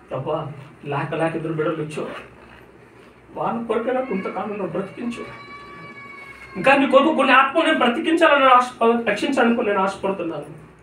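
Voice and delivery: pleading with God on the operating table, weeping; tearful pleading prayer. Retold or imitated. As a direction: pitch climbing high into a crying plea. Retold tearful prayer for life.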